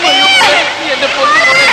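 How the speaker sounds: anguished wail. Acted. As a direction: cry out over the pit for his child. Father wails at the pit.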